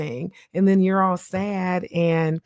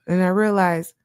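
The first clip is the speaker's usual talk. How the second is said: ordinary talk, nothing marked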